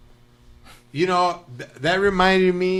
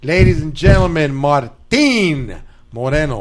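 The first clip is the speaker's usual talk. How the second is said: stage announcer; 7 dB louder than usual. Pretend. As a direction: announce the comic, stretching the name. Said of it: "you introduced me right now, felt like I was gonna go on stage".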